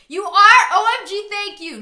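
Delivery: high loud excited squeal; mock thrilled. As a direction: gush like you just got a car. Fake excitement at car news.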